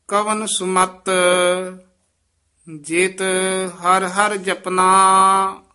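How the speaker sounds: loud, measured scripture reading; full-voiced recitation. Teacher reciting scripture aloud for students, full voice. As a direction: recite it out boldly for the class.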